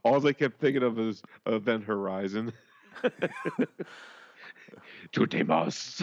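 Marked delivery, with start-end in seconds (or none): 5.1-6.0 low, ominous Latin incantation; sinister low mock incantation; dark Latin voice. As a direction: imitate a dark voice chanting Latin. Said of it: "this real dark voice saying Latin".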